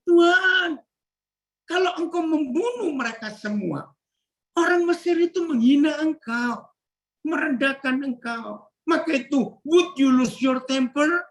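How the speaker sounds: high, fast, urgent pleading with God; Moses pleading. Pretend. Moses warning God the Egyptians will mock, high urgent plea. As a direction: beg God with rising urgency, a near cry. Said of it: "Jadi Musa itu ngingetin".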